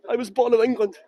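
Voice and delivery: mock outraged "I was born in England"; mock indignant. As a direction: play it like a shocking confession. Mock outrage over English birth.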